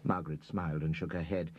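usual speaking voice

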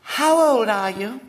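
put-on high, slow childish lisp; lisping little girl. A narrator's reading. Little girl asks William's name, lisping high and slow. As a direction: lisp it like a spoilt small girl.

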